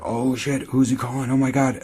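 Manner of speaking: like catching a twist on screen; mock alarm. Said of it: "And I was like".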